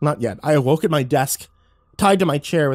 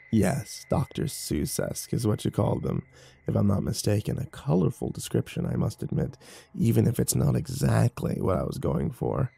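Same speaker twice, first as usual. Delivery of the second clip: raspy creature voice; 3 semitones lower, 6 dB quieter than usual. A narrator's reading. The creature Ruck taunts narrator.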